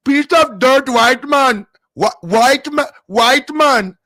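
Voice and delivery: retrying the phrase in high mock Indian accent; loud, high Indian-accent impression; put-on Indian accent. Streamer fixing his pronunciation for chat, loud mock Indian accent. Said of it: "the man is not right".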